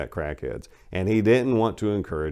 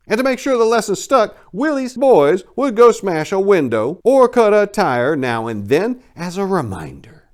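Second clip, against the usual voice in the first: louder and higher, leaning into the threat; menacing emphasis; 12 semitones higher, 8 dB louder than usual. A narrator's reading. Narrator relishes the gang boss's cruelty, loud and high. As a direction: spit out the threat.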